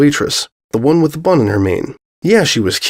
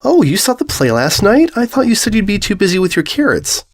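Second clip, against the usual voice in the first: surprised; 9 semitones higher than usual. Acted. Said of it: "Script raised his eyebrows".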